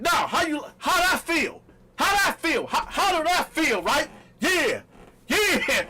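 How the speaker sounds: high, loud mocking taunt; gleeful loud taunting; taunting shout. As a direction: jab the words at her, loud and high. Taunting her with the knife.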